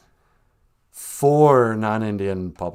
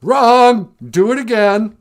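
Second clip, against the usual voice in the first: loud, high barked orders like a strict schoolmaster; furious classroom bellow, pitched up and loud; shouting headmaster; 10 semitones higher, 10 dB louder, slower than usual. Retold or imitated. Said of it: "headmaster in the Pink Floyd The Wall video".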